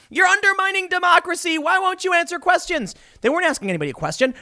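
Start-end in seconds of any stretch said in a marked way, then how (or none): 0.1-2.9 much higher, shrill mock yelling; mocking a reporter shouting questions at an empty room. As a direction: ham up reporters yelling at nobody. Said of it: "They were just randomly yelling things".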